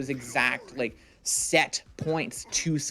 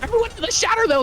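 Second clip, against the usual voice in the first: excited; 5 dB louder, much faster than usual. Real, not acted. Co-caster reacting to big play.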